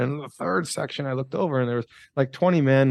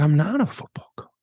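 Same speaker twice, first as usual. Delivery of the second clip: hushed whisper; whispered confession; 4 semitones higher than usual. Retold or imitated. Father whispering the truth to his twelve-year-old son. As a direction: let the father whisper it like a secret.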